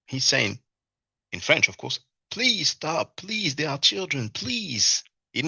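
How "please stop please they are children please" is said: higher, pleading voice, lightly imitating the neighbor; a mild mock plea for the children; imitated pleading neighbor. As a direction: beg her to stop, in a retold imitation. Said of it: "the neighbor is begging her to stop".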